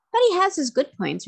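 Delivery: put-on a boy's cheerful protest; cheerfully. A narrator's reading. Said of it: "replied Sacco cheerfully".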